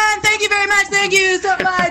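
high, sustained announcer call; mock closing announcement, pitched high; hammed-up emcee sign-off. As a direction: wrap up the act like a show host. Hams up emcee thanks.

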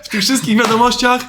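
loud laughing retelling; laughing through the words. Real, not acted.

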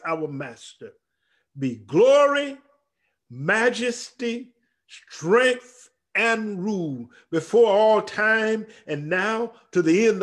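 high, emphatic sermon delivery with drawn-out key words; ringing scripture proclamation; impassioned preaching. Acted. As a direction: preach the verse, stretching the big words.

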